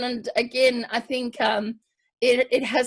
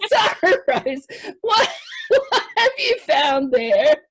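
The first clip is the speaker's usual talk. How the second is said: high, slow, breaking into laughter; can barely get the question out for laughing; laughing through words; 9 semitones higher, 3 dB louder, slower than usual. Real, not acted. Host asks about a swimsuit mask, giggling and high.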